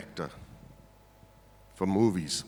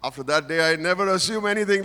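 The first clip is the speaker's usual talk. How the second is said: normal talking voice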